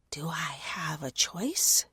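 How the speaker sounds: slow, whispered sigh. Acted. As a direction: let the words out like a sigh. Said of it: "the words coming out like a sigh".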